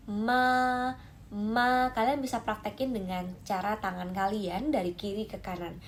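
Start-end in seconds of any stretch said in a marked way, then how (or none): 0.1-1.9 MA high and held level, each time; "Ma" high, even and sustained; level first tone. Shown as a demonstration. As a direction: hold each MA on one high straight note.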